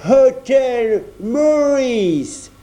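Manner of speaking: put-on stiff, solemn Englishman in a thick English accent. A narrator's reading. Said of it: "prit un accent anglais très prononcé et très solennel". (accent anglais très prononcé et solennel, très lent)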